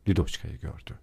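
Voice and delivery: ordinary delivery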